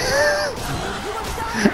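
high squealing laugh. Real, not acted. Out of breath in game.

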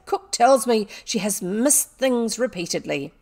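loud, accusing schoolmistress; acted angry accusation; angry scolding. A narrator's reading. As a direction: lay out the charge sternly and loudly. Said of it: "Miss Minchin's angry voice".

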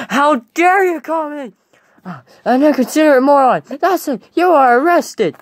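high-pitched, affronted protest; indignant outrage. Acted. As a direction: declare it in high, hammed-up offence. Acted indignant quarrel.

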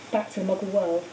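ordinary delivery, nothing marked

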